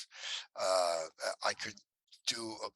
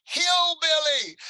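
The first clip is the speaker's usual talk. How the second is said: much higher, louder, slow mock shout; the children blurting out in amazement; stretched, high-pitched shout; kids shouting hillbilly; 12 dB louder, much slower than usual. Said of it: "and they went".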